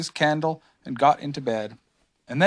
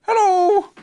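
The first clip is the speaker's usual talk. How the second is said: high, louder calling bear voice; Pooh; 8 dB louder than usual. A narrator's reading. Pooh greets whatever is outside, high call. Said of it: "said Pooh".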